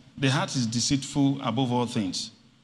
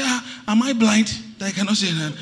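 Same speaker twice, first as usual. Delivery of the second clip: higher-pitched indignant quote; mock indignation, pitch raised; indignant retort; 9 semitones higher, 4 dB louder than usual. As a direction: act out an offended comeback. Retold indignant reply.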